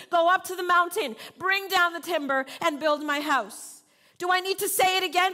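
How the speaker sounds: loud, high, driving sermon; preaching at full force. Acted. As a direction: hammer the three steps into the crowd. Preaching the three steps loud.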